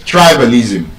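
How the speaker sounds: Tribalism stressed on the first syllable, TRI; TRI-balism, front syllable punched; correct stress. Shown as a demonstration. Teacher repeats the correction, first-syllable stress. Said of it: "stressed on the first syllable".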